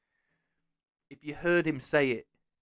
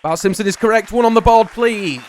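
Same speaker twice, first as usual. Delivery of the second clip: loud, high, triumphant announcement; game-show announcer; 8 semitones higher, 11 dB louder than usual. Pretend. Host confirms the contestant's right answer, excited announcer shout. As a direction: build to a big shout on the score.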